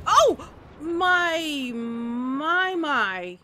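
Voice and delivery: loud high oh, then my my my falling back down; amazed exclamation. Real, not acted. Player marvelling at the view, loud surprised exclamation. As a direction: gasp out in awe, pitch sliding down.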